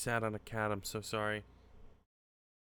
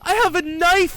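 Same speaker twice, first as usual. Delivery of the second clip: the same high scream, snapped out at normal speed; quick shriek; 15 dB louder than usual. Real, not acted. Frustrated gamer shrieking quickly about having a knife. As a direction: fire it off fast at the top of your voice. Player screams at game puzzle.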